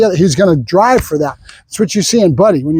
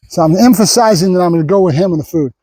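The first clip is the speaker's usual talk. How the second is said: ordinary talk, nothing marked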